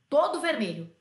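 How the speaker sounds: plain reading voice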